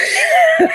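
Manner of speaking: sudden squeal of a laugh; high burst of laughter. Real, not acted. Laughing at the man on the radio show, a sudden high laugh. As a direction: break into a shrill giggle.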